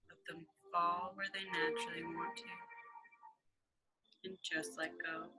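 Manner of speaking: hushed, calm, half-whispered guidance; guided meditation. Acted. Guided meditation over music.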